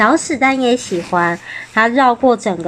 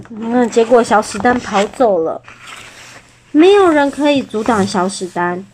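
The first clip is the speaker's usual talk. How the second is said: plain reading voice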